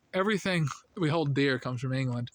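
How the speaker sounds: ordinary talk, nothing marked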